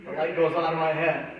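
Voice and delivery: ordinary talk, nothing marked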